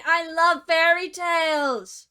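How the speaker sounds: slow, high, loud put-on glee; mock exclamation. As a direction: belt it in fake delight. Final sarcastic cheer.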